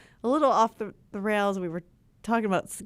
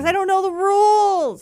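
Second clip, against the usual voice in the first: loud protest; 11 semitones higher, 8 dB louder than usual. Real, not acted. Playful protest.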